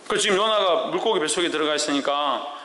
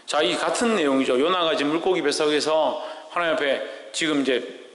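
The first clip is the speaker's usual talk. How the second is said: usual speaking voice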